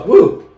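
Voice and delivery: high loud woo from the burn; loud heat woo. Real, not acted. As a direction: shout out the spice. Reacting to spicy heat.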